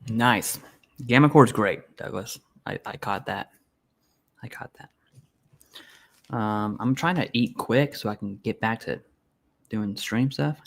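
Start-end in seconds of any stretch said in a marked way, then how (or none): 4.4-6.3 whispered aside, barely voiced; under the breath. Real, not acted. Streamer reacting to a chat comment, whispered aside. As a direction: repeat it softly to yourself in a whisper.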